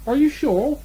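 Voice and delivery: high, astonished question; surprised. Acted. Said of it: "still more surprised".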